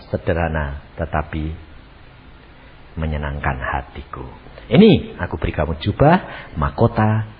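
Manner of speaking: calm, low-pitched divine reassurance; gentle Jesus. Acted. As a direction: speak tenderly as Jesus to a faithful woman. Acts Jesus speaking to dying woman.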